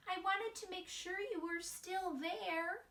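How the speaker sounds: put-on high small child voice; little mouse voice. Wemberly peeking at her parents, high and timid. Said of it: "peeking in on her parents".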